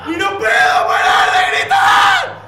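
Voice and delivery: mock desperate scream. Real, not acted. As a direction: yell out for help at the top of the lungs. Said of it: "tengo el grito en mi oreja".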